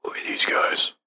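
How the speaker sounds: low-voiced, whispery aside; confiding aside. Guard to his buddy about strangers, whispery aside. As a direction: say it softly, just for your buddy.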